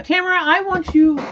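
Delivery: high, loud call to Tamara. Real, not acted. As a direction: call her over from across the room. Calling to Tamara across the room.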